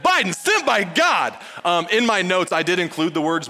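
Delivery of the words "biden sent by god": high, loud mock astonishment; mock outraged reaction. Preacher mimics a listener reacting to a hot take, mock outrage. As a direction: exclaim it like a scandalized listener. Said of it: "in case you're like".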